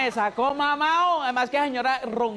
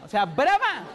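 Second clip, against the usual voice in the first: ordinary talk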